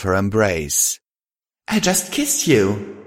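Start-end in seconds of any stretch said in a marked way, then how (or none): 1.7-3.0 high, slow, stunned; dazed, drawn-out statement; blank realisation. A narrator's reading. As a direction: let the realisation sink in slowly. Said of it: "Twilight said blankly".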